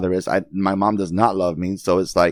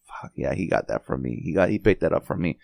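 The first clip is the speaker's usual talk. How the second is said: low muttered self-blame; 5 semitones lower, 6 dB quieter than usual. Retold or imitated. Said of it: "I'm like".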